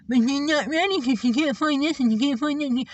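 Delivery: high, pinched sing-song nagging imitation; mock whining. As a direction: parody a tiresome complainer. Mimicking someone's repeated complaints.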